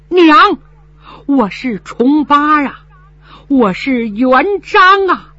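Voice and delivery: slow, high, sobbing plea; tearful son. Acted. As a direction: weep as you tell her who you are. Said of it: "泪如雨下". (哭着喊娘，带着哭腔)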